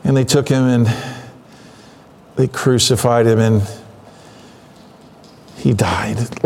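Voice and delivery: low, halting, after a heavy sigh; painful to say. Acted. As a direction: act out struggling to get painful words out, with long pauses. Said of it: "it's painful to even say it".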